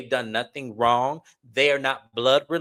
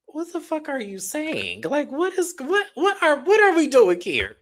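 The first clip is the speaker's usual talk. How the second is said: much higher, incredulous and exasperated; stunned disbelief; 13 semitones higher, 3 dB louder than usual. Retold or imitated. Staring at Miss Nell in stunned, high-pitched disbelief. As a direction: react like you can't believe what you just heard. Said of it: "I'm looking at Miss Nell like".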